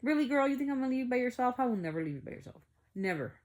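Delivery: fast teasing retort, starting high and loud; mock the very idea, rattling it off; imitating a mother's mocking reassurance. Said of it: "Kinda, like, in a mocking voice".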